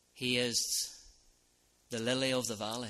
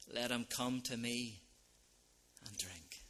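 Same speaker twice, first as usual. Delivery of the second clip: quiet echo; 8 dB quieter than usual. Acted. Preacher softly repeating Jesus' call, fading. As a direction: let it fall to a hush. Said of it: "said in a loud voice".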